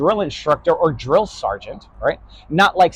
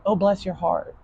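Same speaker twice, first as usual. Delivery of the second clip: softer, gentle fake politeness; polite sweet talk; 3 semitones higher, 6 dB quieter than usual. Shown as a demonstration. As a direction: say it softly like a polite southerner. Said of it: "you have to say things like".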